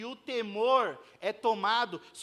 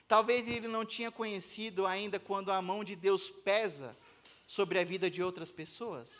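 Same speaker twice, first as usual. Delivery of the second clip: ordinary talk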